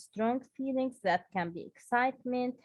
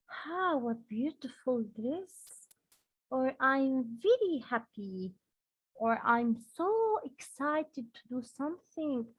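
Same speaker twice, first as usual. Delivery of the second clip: usual speaking voice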